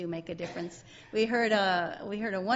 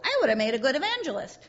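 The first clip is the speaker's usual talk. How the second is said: voicing Sally; 6 semitones higher, 5 dB louder than usual. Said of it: "Sally talking to Linus".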